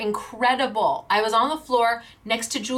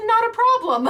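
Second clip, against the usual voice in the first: pitched-up, louder carefree reply; high breezy quote; 13 semitones higher, 6 dB louder than usual. Retold or imitated. Owner retelling the boss's easy yes, high and bright. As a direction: chirp it high, no worries at all. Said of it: "She said".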